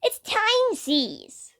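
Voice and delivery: put-on squeaky slow kid voice, mouth full; high little girl voice. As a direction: squeak it out like a small child. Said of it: "with her own mouthful of candy".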